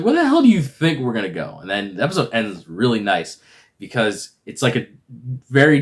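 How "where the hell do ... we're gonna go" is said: high, loud incredulous comeback; put-on irritated retort; mock exasperation. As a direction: throw the question back impatiently. Acted Khonshu snapping at Mark.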